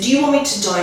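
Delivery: put-on a child calling out to his mother in despair, lightly shouted; retold scream. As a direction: cry it out at her in despair. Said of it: "I remember saying it so loud".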